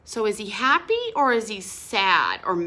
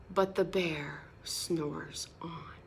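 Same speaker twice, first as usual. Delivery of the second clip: soft slow refrain; 5 semitones lower, 8 dB quieter, slower than usual. A narrator's reading. Refrain read softly.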